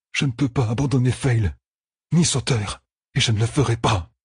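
high, strained-effort vow; desperate resolve. Acted. Hero facing the arrow, refusing to abandon friends, desperate resolve. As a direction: raise the pitch and insist urgently.

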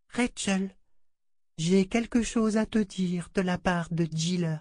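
high, hushed doll voice; a doll confiding a message; murmuring doll. A narrator's reading. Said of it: "le murmure de sa poupée". (murmure aigu de poupée)

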